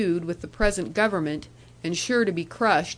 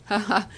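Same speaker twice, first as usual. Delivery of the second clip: put-on old man laugh.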